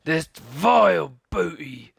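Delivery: loud, slow scornful pirate voice; captain scorning the treasure; disgusted pirate. Acted. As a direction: spit the words out.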